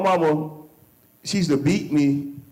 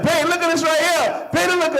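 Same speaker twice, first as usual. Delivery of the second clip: much higher, her voice calling him over; imitating wife; 12 semitones higher than usual. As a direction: mimic his wife calling babe to come look. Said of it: "she like".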